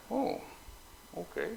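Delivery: high, airy shrug of a reply; mock unbothered. Shrugging off the missile alert, mock unbothered. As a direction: act as if three hundred missiles are no big deal.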